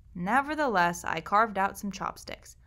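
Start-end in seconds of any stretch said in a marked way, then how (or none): none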